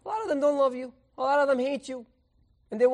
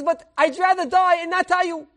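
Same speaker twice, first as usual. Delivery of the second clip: high, emphatic acted refusal; desperate defiance; 7 semitones higher, 3 dB louder than usual. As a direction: refuse in a raised, pleading voice. Teacher defies the death threat.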